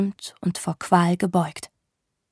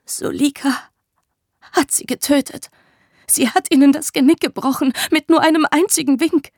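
higher, raw and building louder; painfully hoarse; 7 semitones higher, 5 dB louder than usual. Acted. Woman confessing the killings, hoarse and rising. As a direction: force the words out through a raw throat. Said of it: "klang ihre Stimme schmerzhaft rau".

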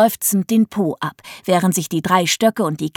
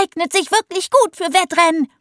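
put-on girl's voice; 9 semitones higher than usual. A narrator's reading. Millie keeps cheering.

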